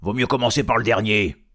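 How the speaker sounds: insistent troll. Acted. Troll voice suggesting the last sack, loud insistence. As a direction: declare it loudly to the others.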